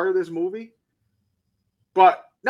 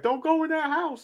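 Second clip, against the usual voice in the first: mock yell; 12 semitones higher than usual. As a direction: call out a warning in a high, restrained yell. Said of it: "He's yelling to her".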